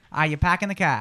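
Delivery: New England accent, R's dropped; Eastern New England accent. Shown as a demonstration. Host models New England accent for Natalie to copy. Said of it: "Eastern New England".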